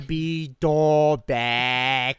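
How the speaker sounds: put-on very slow Boston accent. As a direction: stretch the name in Boston accent. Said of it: "say in a Boston accent".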